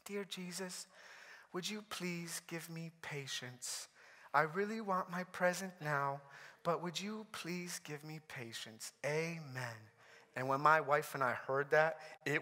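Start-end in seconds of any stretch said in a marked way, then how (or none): 0.1-9.9 put-on tiny, very soft praying little girl; cutest child voice. Daughter praying for patience in the van, cute small voice. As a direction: imitate a small child's hushed, earnest prayer. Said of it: "like, the cutest voice".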